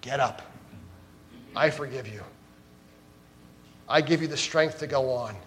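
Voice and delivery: soft, quiet, reassuring; a hint of Jesus's gentle voice. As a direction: lift them up gently. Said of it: "hear the voice of Jesus saying to you".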